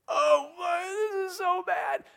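high, drawn-out fake crying wail; mock lament. Preacher acting a lament psalm's complaint, mock tearful wail. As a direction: wail it slowly like an exaggerated mourner. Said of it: "in the lament psalms".

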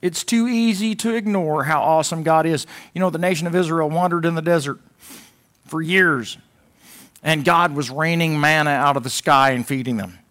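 rising pulpit fervor; full-force preaching. Acted. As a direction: preach it to the back row.

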